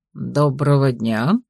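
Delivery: low, slow courteous greeting; a gentleman bowing politely; polite older man. A narrator's reading.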